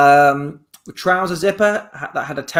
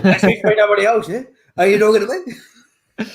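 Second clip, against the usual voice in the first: British take on a film tough guy, half laughing; lightly voiced British movie hard man; Robert De Niro; faster than usual. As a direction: play the tough challenge for laughs. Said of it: "I'm British Robert De Niro".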